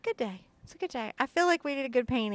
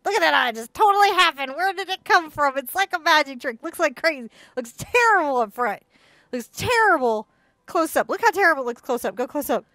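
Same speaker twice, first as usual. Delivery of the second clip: loud, high excited gushing; real excitement; 5 semitones higher, 8 dB louder than usual. Real, not acted. Painter showing students the eye appear, really excited. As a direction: get carried away, pitch and volume jumping up. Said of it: "I got a little excited".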